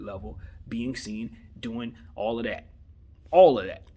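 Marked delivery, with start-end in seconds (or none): 3.3-3.8 exasperated loud outburst; loud indignant repeat. Real, not acted. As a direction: punch it out much louder, exasperated. Indignant repeat, much louder.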